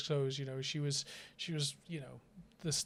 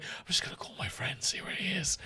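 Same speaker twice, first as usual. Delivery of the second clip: whispered excuse; 4 semitones higher than usual. Retold or imitated. Retold hungover whisper.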